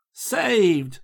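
high, stagey cry of deliverance; relieved cry. A narrator's reading. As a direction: exclaim it like a rescued melodrama heroine. Relieved girl cries out, swoons joyfully.

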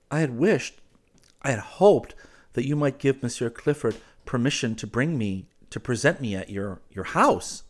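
tentative, with hopeful rises; hesitant hopeful suitor. Acted. He asks to be presented at her house, hesitantly.

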